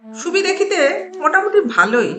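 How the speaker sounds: ordinary delivery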